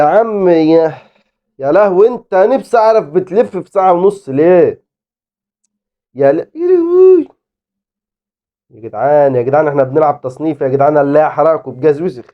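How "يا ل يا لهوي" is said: high, drawn-out dismay; shocked cry. Real, not acted. Gamer crying out as play goes wrong. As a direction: wail it out in disbelief.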